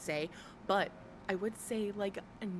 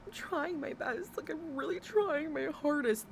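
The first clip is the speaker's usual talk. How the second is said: mock whiny excuse; 6 semitones higher than usual. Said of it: "if you were sitting there and saying".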